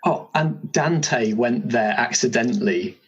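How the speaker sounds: ordinary talk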